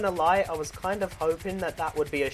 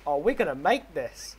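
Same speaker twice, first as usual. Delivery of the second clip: ordinary delivery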